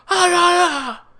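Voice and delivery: high loud strangled yells imitating Ken; mock angry outburst. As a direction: squeal out his rage like a cartoon.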